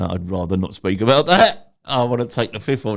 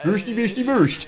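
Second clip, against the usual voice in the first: high, jokey mock Norwegian accent; 11 semitones higher than usual. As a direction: imitate a Norwegian accent for laughs. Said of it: "I don't know what a Norwegian accent is".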